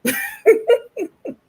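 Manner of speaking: sudden high loud laugh. Real, not acted. Laughing at the Uber driver's Deuteronomy argument.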